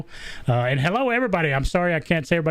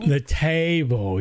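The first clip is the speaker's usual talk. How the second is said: slow, stretched Southern drawl on "table"; much slower than usual. Retold or imitated. Host echoing guest's table, slow Southern drawl. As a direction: imitate a long Southern drawl. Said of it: "the Southern drawl".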